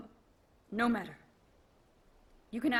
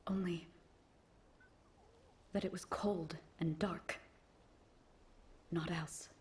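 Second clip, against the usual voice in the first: hushed remembering; 2 semitones lower, 5 dB quieter, slower than usual. Acted. Knight recalls cold and darkness.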